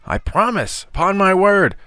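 high-pitched frantic begging; desperate, squealing promise; earnest pleading. A narrator's reading. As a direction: plead to be released. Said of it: "this earnest request".

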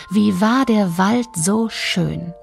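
ordinary delivery

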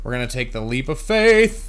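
loud, rising to a high yell; excited shout. Real, not acted. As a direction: build up to a big cry on the last word. Hyped up before a jump.

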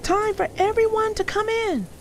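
put-on mother calling children indoors; high, loud, carrying call. A narrator's reading. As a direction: call it across the yard. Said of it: "called his mother".

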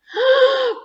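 loud, high theatrical gasp; shocked gasp. A narrator's reading. Narrator reacting as the robber hits the boxes, shocked. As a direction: gasp sharply before the collision. Narrator gasps before the crash.

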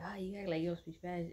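ordinary talk

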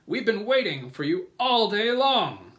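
high, very loud grasshopper voice; old grasshopper character. A narrator's reading. Old green grasshopper welcomes James, loud high exclamation. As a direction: call it out high, stretching the end. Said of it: "the old green grasshopper said".

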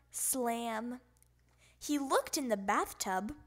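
ordinary delivery, nothing marked

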